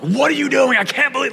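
high, loud mock yell of exasperation; imitating a yell. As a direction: shout it the way everyone used to snap. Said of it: "everyone just yelled".

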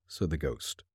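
usual speaking voice

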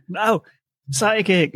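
mock-exasperated, spell it out for someone deaf; exasperated correction. Finally correcting the deaf father at the party, exasperated. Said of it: "eventually I just go".

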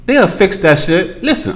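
ordinary talk, nothing marked

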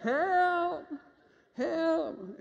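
mock cry for help from the roof, dying away; retold yelling. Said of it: "So I start yelling".

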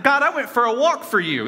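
high, mock-aggrieved plea to God; indignant inner voice. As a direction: sound put out that your effort went unnoticed. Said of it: "in my head, you know?".

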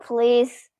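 ordinary delivery, nothing marked

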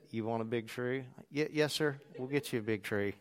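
low, soft, laid-back worker voice; tree lot worker. Retold or imitated. Tree lot worker obliging a customer who wants a big tree, low and quiet.

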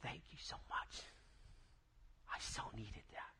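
tearful whispered thanks, imitated; retold sobbing. Big man sobbing his thanks after a prayer, imitated in a tearful whisper. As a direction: choke it out in a hushed, breathy whisper. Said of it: "He's sobbing. He's like".

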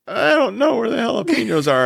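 high, lost and panicky; flustered panic. Retold or imitated. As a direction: act totally overwhelmed. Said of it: "I'm like".

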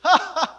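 a quick laugh as Abram; brief burst of laughter. Retold or imitated. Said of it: "he laughs".

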